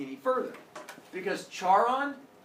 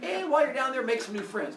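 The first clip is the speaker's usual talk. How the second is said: cheery parent; 8 semitones higher than usual. Acted. Parents stuffing coins in their dead daughter's mouth, chirpy send-off. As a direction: send her off like a chirpy mom.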